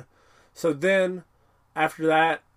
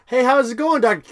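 higher, louder casual hello; breezy greeting; 8 semitones higher, 7 dB louder than usual. Retold or imitated. Zoidberg's kin greeting the doctor, breezy and loud. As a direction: toss off a friendly hey. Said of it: "He's like".

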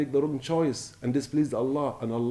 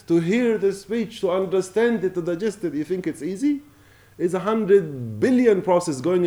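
louder and higher, pressing the point home; impassioned plea to value health, louder and higher; fervent preaching; 5 semitones higher, 5 dB louder than usual. Real, not acted. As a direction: preach it hard, raising the voice. Preacher rises to full force.

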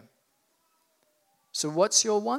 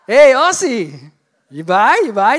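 loud hawker call in a mock Balinese accent; bad Balinese accent; 4 semitones higher, 12 dB louder than usual. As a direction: holler at a passing tourist, faked Balinese accent. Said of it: "Someone else comes".

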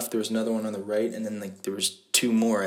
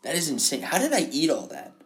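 amazed self-quote; 6 semitones higher than usual. Retold or imitated. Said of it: "I'm like".

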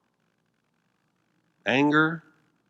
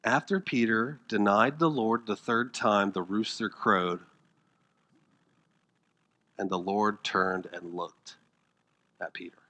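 scripture reading; 5 semitones lower, 8 dB quieter than usual. Acted.

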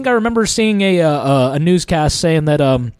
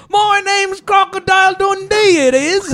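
high, badly faked Australian accent; Crocodile Dundee accent; 12 semitones higher than usual. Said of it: "about as good as yours".

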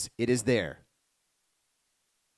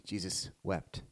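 low and hushed, heavy with grief; 4 semitones lower, 10 dB quieter, slower than usual. Acted. Preacher reading the verse to the congregation, hushed and low. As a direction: let it sink, soft and low.

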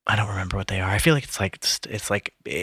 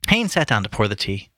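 ordinary delivery

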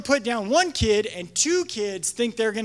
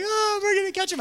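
put-on high playful kid voice; taunting kid; 11 semitones higher, faster than usual. Kids chasing the leader, high teasing voice. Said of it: "They're like".

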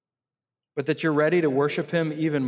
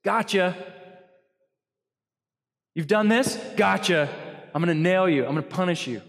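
accusing gotcha; 4 semitones higher than usual. Retold or imitated. Pastor mocking a punishing God, accusing and higher. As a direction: catch them out with a sneer.

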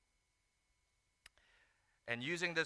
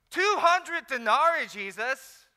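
snarky, slow, high and loud; a sarcastic disciple scoffing at an impossible request; 13 semitones higher, 18 dB louder, slower than usual. Acted. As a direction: throw it back at him with sarcasm. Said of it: "adding tone in that response".

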